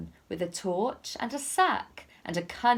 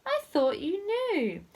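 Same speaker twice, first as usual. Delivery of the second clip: knowing goose; 11 semitones higher than usual. Acted. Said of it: "The goose replied".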